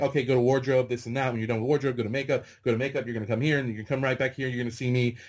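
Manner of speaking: imitating a fast-talking assistant director; rapid-fire. Hurried man with clipboard ordering new extras about, rapid-fire. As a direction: rattle off instructions without a breath. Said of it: "He's talking a million miles a minute".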